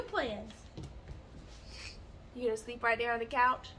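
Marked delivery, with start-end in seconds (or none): none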